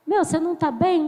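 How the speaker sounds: ordinary talk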